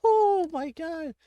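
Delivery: high, drawn-out, slow oh my god; shocked gasp. Real, not acted. Player stunned as the cat demands the prey, slow and high. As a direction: stretch it out in stunned alarm.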